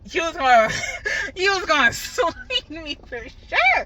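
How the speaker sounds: loud, high, breaking into real laughter; laughing. Real, not acted. Vlogger recalling an angry man nearly swinging, laughing. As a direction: laugh through the words, drawing out the end.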